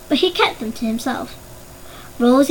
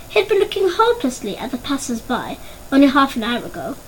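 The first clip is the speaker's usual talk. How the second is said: ordinary delivery, nothing marked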